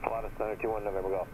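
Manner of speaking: usual speaking voice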